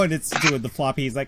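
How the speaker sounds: normal talking voice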